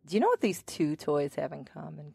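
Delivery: normal talking voice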